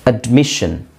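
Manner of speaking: ordinary talk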